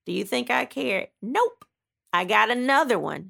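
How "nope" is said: defiant. Real, not acted. Defiant answer to own question.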